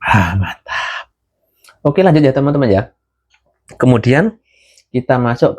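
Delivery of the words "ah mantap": relaxed sigh after a sip; satisfied sigh after coffee. Real, not acted. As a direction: breathe out, pleased after a hot sip. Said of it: "Saya seruput kopinya dulu sebentar".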